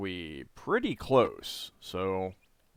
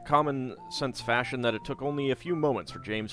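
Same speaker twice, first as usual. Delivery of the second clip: steady storybook read-aloud; story narration; 4 semitones higher, 3 dB louder than usual. A narrator's reading. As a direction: read the quest text like a novel. Reading the game's story text.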